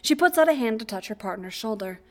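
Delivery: ordinary delivery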